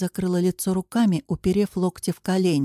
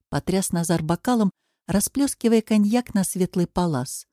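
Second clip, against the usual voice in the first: ordinary delivery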